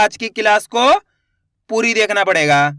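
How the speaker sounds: normal talking voice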